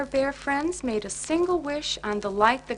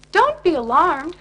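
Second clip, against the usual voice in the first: bright, higher, louder call; cheerful shout; 6 semitones higher, 9 dB louder than usual. Acted. Cheer Bear calming the startled bears, cheery shout. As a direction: reassure everyone with a cheery shout. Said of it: "shouted Cheer Bear cheerfully".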